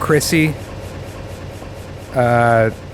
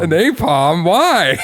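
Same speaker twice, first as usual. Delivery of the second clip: incredulous; 5 semitones higher, much slower than usual. Retold or imitated. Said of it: "I was like".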